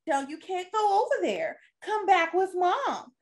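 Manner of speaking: high, pleading mom voice. Retold or imitated. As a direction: call a child back in an exaggerated high lilt. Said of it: "yeah, they be like".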